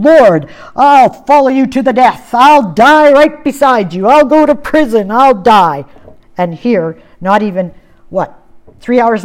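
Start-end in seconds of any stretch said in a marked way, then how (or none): 0.0-4.1 louder, higher impassioned oath; vehement vow. Retold or imitated. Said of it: "after vehemently saying". 4.1-5.1 "I'll go to prison" said higher and louder, an impassioned oath; vehement vow. Retold or imitated. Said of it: "after vehemently saying". 5.1-5.8 vehement. Retold or imitated. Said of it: "after vehemently saying".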